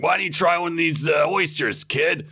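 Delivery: a mild American dad offering oysters; American accent. Retold or imitated. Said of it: "Is that your American accent?".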